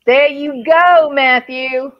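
loud, drawn-out cheer; cheering him on. Real, not acted. Fan cheers on the actor's speech, loud holler.

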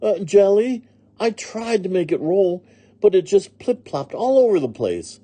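high, innocent maid's explanation; Amelia Bedelia explaining. A narrator's reading. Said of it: "said Amelia Bedelia".

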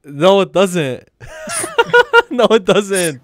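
loud, drawn-out denial through laughter; laughing disbelief. Real, not acted. Friend scoffing at how the locks look, laughing disbelief. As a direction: crack up while flatly refusing to agree.

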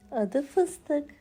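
plain reading voice

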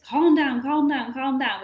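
loud, high repeated calm down; imitating a parent urging kids to calm; mock calm down. Parent to upset kids, loud pleading calm down.

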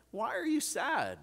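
high, drawn-out mock bewilderment; clueless husband. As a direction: imitate a husband who just doesn't get it. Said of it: "sound like a husband that does, doesn't have a clue".

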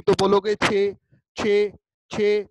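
"छह" ज़ोर से तीन बार दोहराना; repeated emphasis. Shown as a demonstration. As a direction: drill the number "छह" loudly, over and over. Teacher drilling the answer. ("छह" को ऊँची आवाज़ में बार-बार दोहराना)

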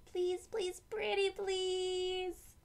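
put-on high pleading little girl voice; girl pleading with her mom for the bike; begging child. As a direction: beg sweetly, drawing it out.

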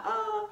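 high, delighted "ah"; happy squeal. Real, not acted. Vlogger saying life is so good right now, high happy squeal. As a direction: let out a small high squeal of happiness. Thrilled about how things are going.

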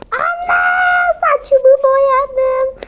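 put-on child calling out about her hair; child shouting. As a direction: yell for mom in alarm.

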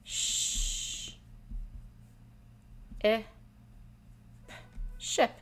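sh, I, p said apart, then blended into ship; segmenting sounds. Shown as a demonstration. Teacher showing students how to segment ship. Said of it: "They would say and write".

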